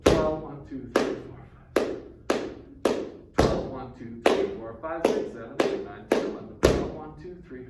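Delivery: "Twelve" punched loud like a downbeat, count running fast after. Shown as a demonstration. Teacher showing how the ear hears twelve as one, loud downbeat. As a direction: hit "Twelve" hard as if it were "one". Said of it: "Where's one?".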